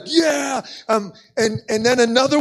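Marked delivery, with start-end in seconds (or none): 0.1-0.6 high, imitated triumphant shout; amped crowd cheer. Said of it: "the people would get more amped".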